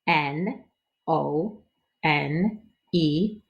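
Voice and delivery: usual speaking voice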